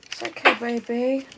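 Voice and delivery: rising soothing hush. Real, not acted. Soothing a fussing baby.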